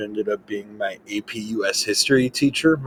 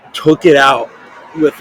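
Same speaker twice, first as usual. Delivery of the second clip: ordinary delivery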